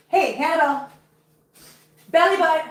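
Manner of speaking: high-pitched call to a cat; calling a pet. Real, not acted. Calling her cat over.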